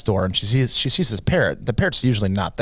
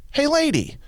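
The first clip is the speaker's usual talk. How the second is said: very high parrot call. Acted. Parrot calling as the woman passes, high parrot voice. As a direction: sing out as a parrot, pitched way up. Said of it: "Parrot goes".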